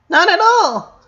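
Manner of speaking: put-on high, bright girl's voice; suddenly happy. A narrator's reading. Leni brightly answering her brother, suddenly happy. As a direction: chirp it out, suddenly cheerful. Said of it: "suddenly happy as usual".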